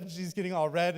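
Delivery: ordinary talk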